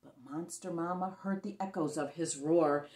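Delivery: ordinary delivery, nothing marked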